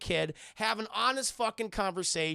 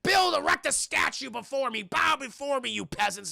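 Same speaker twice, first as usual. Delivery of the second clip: loud, higher ranting tyrant; gloating shout; 3 semitones higher, 6 dB louder than usual. Retold or imitated. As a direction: bellow it down at your subjects. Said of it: "Saddam Hussein's like".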